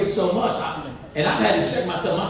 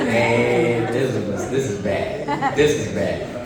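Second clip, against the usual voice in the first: low, slow mock dread at what's coming; lowered dread; 6 semitones lower, slower than usual. Dad watching his son head down the steep hill, low mock dread. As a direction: mutter it like you see the crash coming.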